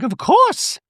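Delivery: very high-pitched mock-chirpy caricature; ditzy reality-star send-up, far above normal pitch; Dorit. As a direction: squeal it out in a silly falsetto. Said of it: "And she's like".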